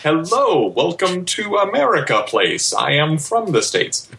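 high, badly faked American accent; hammed-up put-on American greeting; fake American accent. British guest mocking himself as an American, in a fake American accent. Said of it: "Better than your fake American accent".

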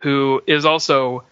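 ordinary talk, nothing marked

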